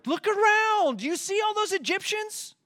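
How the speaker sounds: panicked Israelite. Retold or imitated. Frightened Israelite tapping Moses' shoulder, high and incredulous. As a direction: squeak it out in disbelief. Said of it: "tapping Moses' shoulder".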